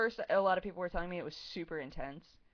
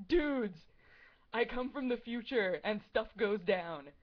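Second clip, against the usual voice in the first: higher, louder, a hint of a dramatic time traveller; cool Trunks; 4 semitones higher, 4 dB louder, slower than usual. Quoting Trunks arriving from the future, mock dramatic. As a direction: announce doom like a hammy anime hero. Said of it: "Trunks showing up and being like".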